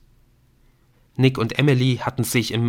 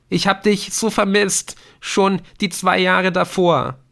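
much higher, near-breaking son; aching longing; 10 semitones higher, 5 dB louder than usual. Acted. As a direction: confess how much you missed him. Adrian confesses missing him. (sehnsüchtig, mit brechender Stimme)

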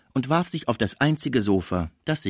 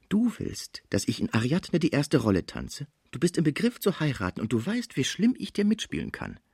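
high, confidential dancer's voice; dancer, wheedling threat; 5 semitones higher than usual. Acted. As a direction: threaten him sweetly, pitched high. (schmeichelnd drohende Tänzerin, hohe Stimme)

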